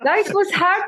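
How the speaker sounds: high, laughing denial; laughing protest. Real, not acted. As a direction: laugh it off while denying.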